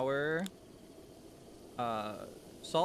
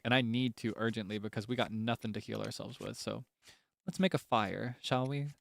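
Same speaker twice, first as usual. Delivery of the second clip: normal talking voice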